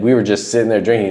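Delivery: ordinary talk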